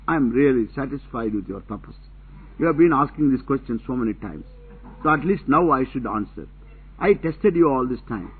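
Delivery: ordinary talk, nothing marked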